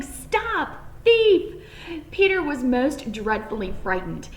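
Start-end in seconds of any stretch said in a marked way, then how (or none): 0.0-1.5 high, loud angry shout. A narrator's reading. Farmer chasing Peter, high loud shout. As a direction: yell stop thief at the rabbit.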